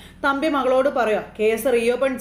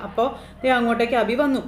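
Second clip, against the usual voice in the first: ordinary talk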